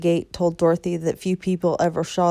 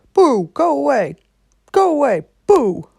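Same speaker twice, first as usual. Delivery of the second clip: loud high shooing shouts; shooing crows; 7 semitones higher, 8 dB louder than usual. Acted. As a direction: chase the birds off. Scarecrow shoos the crows.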